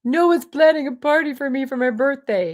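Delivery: high, loud whining complaint; whiny younger self. Retold or imitated. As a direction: whine it like a sulky twenty-nine-year-old. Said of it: "and I'm whiny".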